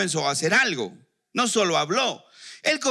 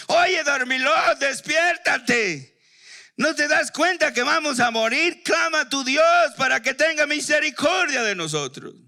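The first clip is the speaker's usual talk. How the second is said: frantic sailors shouting; 9 semitones higher than usual. Acted. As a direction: shake Jonah awake, yelling in panic. Sailors wake sleeping Jonah in storm.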